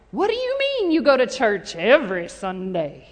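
stretched-out, disbelieving question; mock incredulous. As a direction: act astonished by someone's odd habit. Acting out incredulous doubters.

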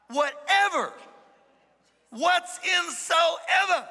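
high, loud, drawn-out proclamation; emphatic preacher's exclamation. Real, not acted. Preacher hammering a scripture word to the congregation, emphatic and high. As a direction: belt out each word, high and stretched.

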